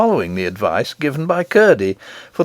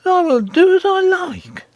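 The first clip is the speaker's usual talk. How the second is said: very high, defiant queen; petulant, pitched far up; sulky retort. A narrator's reading. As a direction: sulk in a high put-on voice. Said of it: "retorted the Queen sulkily".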